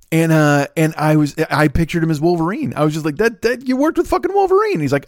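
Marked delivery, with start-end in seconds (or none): 3.2-4.8 high-pitched amazed squeal; amazed disbelief. Retold or imitated. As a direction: pitch way up in mock astonishment. Amazed at stepdad's coworker.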